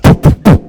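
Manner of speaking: loud breathy punch noises; voiced hits on the chest; punch sounds. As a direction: mimic the blows. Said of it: "she's like".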